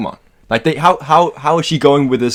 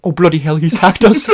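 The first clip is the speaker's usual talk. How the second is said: mock-flustered Q, rising and getting louder; slightly aroused-sounding; 4 semitones higher, 5 dB louder than usual. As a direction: imitate Q with accidental over-excitement. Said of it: "sound slightly, like, sexually excited".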